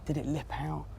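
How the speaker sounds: quiet, hushed golf-course voice; hushed tones. Real, not acted. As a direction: keep it down so the golfer can play. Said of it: "speaking in the hushed tones".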